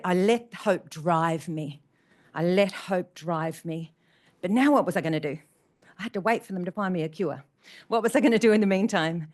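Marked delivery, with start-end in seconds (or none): 0.0-3.9 slow, deliberate. Real, not acted. Slow emphatic repetition.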